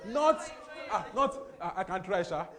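breaks into laughter mid-word; laughing too hard to finish the phrase; real laughter through broken words. Real, not acted. Laughing while preaching.

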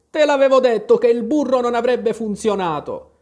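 high, loud, indignant telling-off; angry reproach. A narrator's reading. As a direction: scold with an I-told-you-so. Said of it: "fissando adirato la lepre". (rimprovero adirato)

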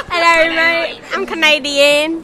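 jokey put-on Australian accent; mock Australian accent. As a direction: imitate a bad Australian accent, drawn out at the end. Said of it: "that's Australian".

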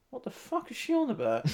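mock-muttered as if the mic is off; off-mic aside. Interviewer privately reacting to a musician's remark, hushed aside. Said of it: "I turned the microphone down".

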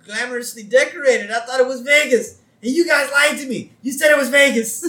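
high, loud, hurt protest; aggrieved whine. Retold or imitated. Homer to friends who lied about Vegas, aggrieved. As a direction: complain loudly that you were lied to. Homer complains he was deceived.

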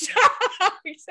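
squeaked out high through a laugh; laughing too hard to say it straight; real laughter mid-word. Real, not acted. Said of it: "Jordan's face was so shocked".

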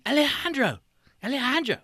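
calling the name out high; hollering for someone in the back room; mock scream of a name. Seller yelling for his helper, high mock call. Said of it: "he starts screaming".

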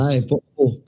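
copycat Scottish "potable"; mock Scottish accent. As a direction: try out a playful Scots accent. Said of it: "Who do you think has a better Scottish accent".

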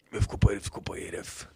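whispered, soft Lula opening; Lula impression. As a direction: breathe it like a hushed Lula. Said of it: "Você sabe imitar o Lula".

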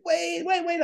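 high frantic wait wait; panicked plea. Retold or imitated. Driver pleads with guards pointing guns, high panicked. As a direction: beg them to hold fire.

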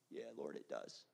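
barely audible, very low reply; whispered admission. Acted. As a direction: confess the hurt to the Lord in a whisper. Acts hurting believer answering Jesus.

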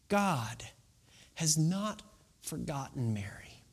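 soft, breathy near-whisper; hushed. Real, not acted. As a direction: lean in and whisper the promise. Preacher hushes the key line.

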